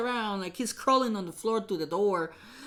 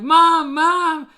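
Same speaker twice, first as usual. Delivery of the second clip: loud retold call for mom, higher; 7 semitones higher, 12 dB louder than usual. Scared kid yelling for mom after a scratch. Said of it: "So I was like".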